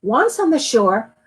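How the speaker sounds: plain reading voice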